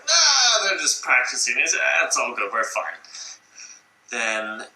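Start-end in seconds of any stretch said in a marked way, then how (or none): 0.1-3.0 dismissive Stalin. Retold or imitated. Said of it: "And Stalin's like".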